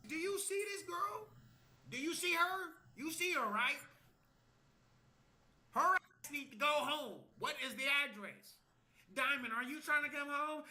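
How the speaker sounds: high-pitched, insistent questioning; exasperated pleading. Retold or imitated. Acted exasperated questioning on a call.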